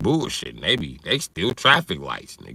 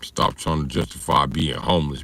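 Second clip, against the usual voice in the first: usual speaking voice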